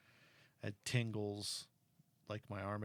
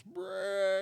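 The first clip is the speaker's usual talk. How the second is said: imitated engine rev burst, high and punchy; loud mock engine growl; engine noise; 9 semitones higher, 6 dB louder than usual. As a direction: make the car's brrrt with your lips. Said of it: "that car goes".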